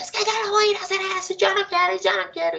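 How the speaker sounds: put-on child refusing. Teacher imitating a child refusing a parent, high and whiny. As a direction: mimic a stubborn little kid saying no. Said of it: "los niños dicen".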